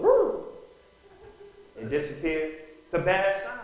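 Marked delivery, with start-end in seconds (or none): 0.0-0.4 high, loud yelp as the dog flees; dog's yelp. Pretend. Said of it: "The dog says".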